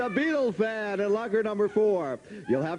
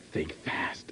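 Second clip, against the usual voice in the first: unvoiced, secretive whisper; 5 dB quieter than usual. Host whispering the show's name to the audience. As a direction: lean in and breathe it like a secret. Whispered sign-off after "Hey, you.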